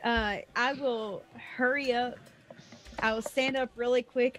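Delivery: ordinary talk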